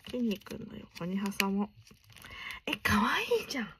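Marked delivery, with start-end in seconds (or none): 2.7-3.7 high, quick whispered delight; whispered cute-overload. Real, not acted. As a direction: gasp it under your breath. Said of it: "あんまり大きい声で叫べれないのがね".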